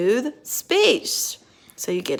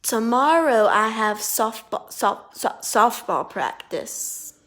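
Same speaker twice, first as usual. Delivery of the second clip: fake stutter. Shown as a demonstration. Therapist acting out a stutter for the student to spot. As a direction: stutter on softball, then slow it out. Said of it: "listen to this sentence".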